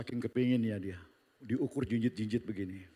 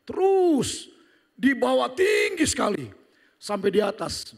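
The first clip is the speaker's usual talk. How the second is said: rising excitement; 10 semitones higher, 9 dB louder, slower than usual. Retold or imitated. Ride climbing high.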